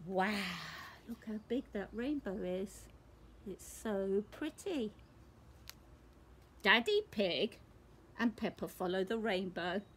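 ordinary delivery

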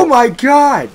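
high, loud exclamation; dismayed yell. Real, not acted. Player attacked by the boomer, loud dismay. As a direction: cry out in disbelief.

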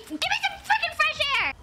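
quick, high burst of exasperation; mad outburst. Real, not acted. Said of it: "I'm so mad".

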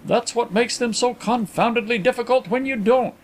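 high-pitched grumble to oneself; soliloquizing. A narrator's reading. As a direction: muse aloud about the difficulty. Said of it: "soliloquized the other".

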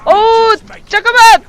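high, loud, rushed protest; panicked yelling. Real, not acted. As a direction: yell as the stealth plan falls apart. Stealth kill goes wrong in game.

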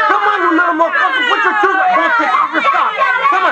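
high, fast scolding. Real, not acted. Scolding child to put shoes on.

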